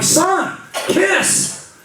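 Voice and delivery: high, laughing correction; mock dad correction. Dad correcting son's band, high laughing correction. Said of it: "I'm like".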